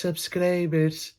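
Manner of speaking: SUBSCRIBERS slow and soft in a Greek accent. Shown as a demonstration. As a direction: model "Subscribers" with plain Greek vowels. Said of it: "πες το με την ελληνική προφορά".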